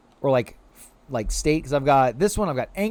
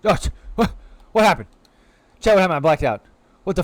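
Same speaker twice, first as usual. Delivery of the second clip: louder, hammed-up startled yelp; mock confused alarm; 3 semitones higher, 6 dB louder, faster than usual. Asking Chad what happened in hammed-up alarm. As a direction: play it like waking up dazed. Acting dazed after a glitch.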